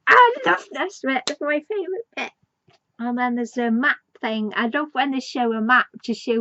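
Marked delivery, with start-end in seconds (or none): 0.1-2.3 higher, louder delighted gush that ends fast; gleeful fan. Retold or imitated. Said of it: "and he's like".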